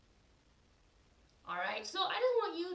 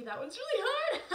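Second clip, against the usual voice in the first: laughing; 9 semitones higher than usual. Real, not acted. Laughs after fumbling twister.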